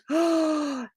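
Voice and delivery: high, drawn-out theatrical gasp; storyteller's gasp. Acted. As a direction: gasp in mock amazement. Narrator gasps at seeing dragon.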